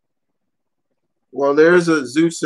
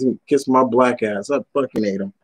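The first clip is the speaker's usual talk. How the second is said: ordinary talk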